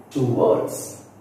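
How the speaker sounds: "towards" with WARDS strong; stress on second syllable. Shown as a demonstration. Teacher listing prepositions, second-syllable stress. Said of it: "we give stress on the second syllable".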